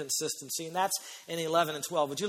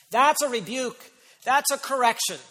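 louder, higher, deliberate declaration; forceful rebuke; 7 semitones higher, 7 dB louder than usual. Shown as a demonstration. Preacher naming the correction to his congregation, forceful. Emphatic rebuke, then tender one.

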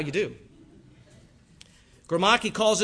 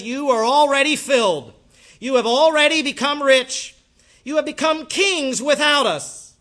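louder sarcastic reading of the praise; mock praise; 8 semitones higher, 8 dB louder than usual. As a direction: read it as biting sarcasm. Reading Paul's sarcastic rebuke.

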